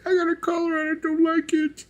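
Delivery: very high squeaky cat voice; voicing the cat. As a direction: play the sulking cat in a tiny high voice. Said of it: "He's like".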